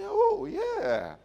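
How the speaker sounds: high, loud, drawn-out "Oh, yeah"; ringing "Oh, yeah". Shown as a demonstration. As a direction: sing it out with real delight.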